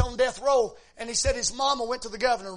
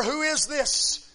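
ordinary talk

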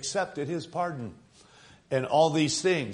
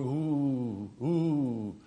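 mournful ghostly moan; 4 dB quieter, much slower than usual. Retold or imitated. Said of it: "and they were going".

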